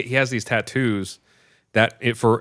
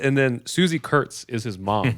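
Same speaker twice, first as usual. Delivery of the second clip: ordinary delivery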